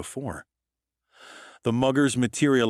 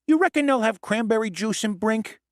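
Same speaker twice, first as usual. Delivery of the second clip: much higher, squeaky little goblin; 13 semitones higher than usual. Acted. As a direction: play the goblin sidekick, pitched way up. Goblin Lennox voiced in story.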